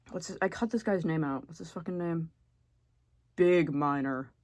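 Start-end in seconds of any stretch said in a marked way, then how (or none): none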